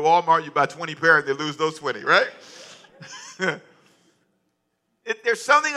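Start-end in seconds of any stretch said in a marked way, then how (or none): 2.3-3.6 a soft airy laugh; short unvoiced chuckle; breathy laugh. Real, not acted. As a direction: laugh quietly at your own joke. Laugh after socks joke.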